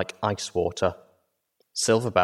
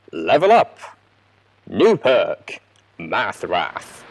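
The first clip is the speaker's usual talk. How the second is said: louder video-game level-up call; game announcer; 6 dB louder, much slower than usual. Acted.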